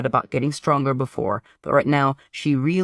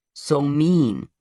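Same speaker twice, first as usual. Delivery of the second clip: pouting. A narrator's reading. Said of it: "The twin pouts".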